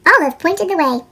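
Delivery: usual speaking voice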